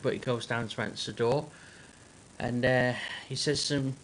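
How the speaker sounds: normal talking voice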